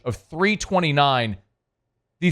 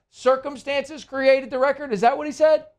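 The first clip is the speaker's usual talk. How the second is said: high incredulous sneer at the quote; mocking disbelief; 8 semitones higher than usual. Real, not acted. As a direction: scoff at the excuse in mock disbelief.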